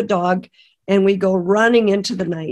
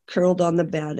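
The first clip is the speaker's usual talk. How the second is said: normal talking voice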